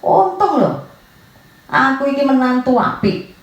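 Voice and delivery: boastful in-law. Retold or imitated. Preacher imitates an in-law boasting to the elders, mock boastful. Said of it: "nggak ada menantu yang berkata".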